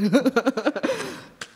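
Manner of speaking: high-pitched laughter. Real, not acted. Laughs after thanking God.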